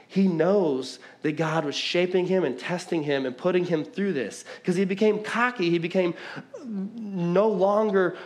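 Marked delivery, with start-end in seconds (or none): none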